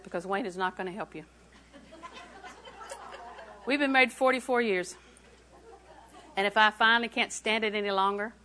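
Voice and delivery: ordinary talk, nothing marked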